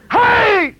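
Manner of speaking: high, loud shout of Hey; imitated call-out. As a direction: holler it across a room. Acts out someone calling out.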